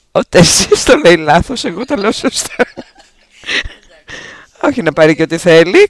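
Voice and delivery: boisterous, very loud insisting with swooping pitch; loud playful protest. Real, not acted. Host insisting on the right song title, loud playful protest. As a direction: argue it at the top of your voice, in fun.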